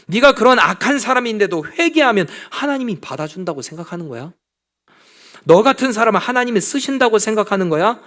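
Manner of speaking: mock the sinner who hopes for grace; the devil mocking believers who repent; Satan's taunt. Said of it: "이렇게 말하는 거죠".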